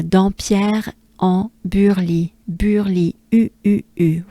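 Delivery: Burly in French, U with rounded lips; droopy French U. Shown as a demonstration. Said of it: "the French droopy sound".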